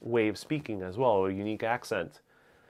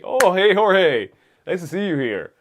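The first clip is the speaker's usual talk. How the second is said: delighted recognition; 6 semitones higher, 7 dB louder than usual. Real, not acted. Recognizing old college friend in chat.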